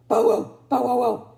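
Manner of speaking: loud barking bow wow; Bitzer Maloney bow wow. Acted. Dog in the strawberry patch barks loudly. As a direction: bark it like a scruffy dog. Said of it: "said Bitzer Maloney".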